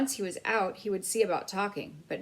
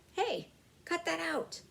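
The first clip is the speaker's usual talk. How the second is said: higher, slower annoyed yelp; sharp protest; 12 semitones higher than usual. A narrator's reading. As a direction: snap at the blinding light. Mouse blinded by flashlight.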